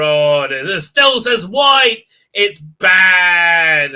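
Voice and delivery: slow, drawn-out mock outcry; mock dismay. People complaining about the sign, mockingly dismayed. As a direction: stretch the last word loudly in mock horror.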